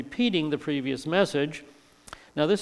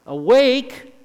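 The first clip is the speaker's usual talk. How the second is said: sudden exclamation; 10 semitones higher, 10 dB louder than usual. Acted. Acting out drowsy half-waking.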